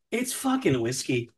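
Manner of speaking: ordinary delivery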